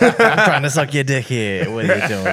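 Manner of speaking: put-on broad comic New York accent. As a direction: ham up an impatient Jersey girlfriend's accent. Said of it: "New York accent".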